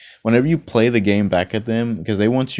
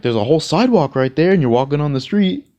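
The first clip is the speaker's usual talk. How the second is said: higher, louder, fast indignant scold; scolding driver; 7 semitones higher, 6 dB louder than usual. Retold or imitated. Driver scolding a walker on the street, indignant. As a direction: lecture him from the car window.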